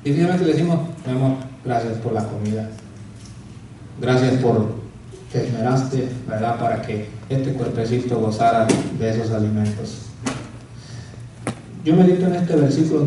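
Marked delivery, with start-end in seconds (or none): 1.0-10.0 soft, low loving thanks; tender thanks. Pretend. Husband thanking his wife for dinner, soft and doting. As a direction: thank her sweetly, almost cooing.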